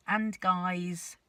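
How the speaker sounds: ordinary delivery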